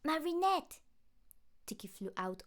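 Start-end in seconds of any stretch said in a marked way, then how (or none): 0.0-0.8 high, loud call across the room; urgent call. Acted. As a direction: call her name to get her attention fast. Tiki urgently calls Marinette.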